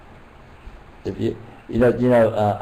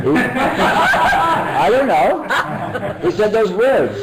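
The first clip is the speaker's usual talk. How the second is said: real laughter through the words, higher; laughing; 12 semitones higher, much slower than usual. Real, not acted. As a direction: laugh it out, bursting into giggles. Laughs answering a question.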